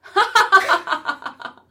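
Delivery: high burst of laughter; loud laugh. Real, not acted. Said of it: "He was not".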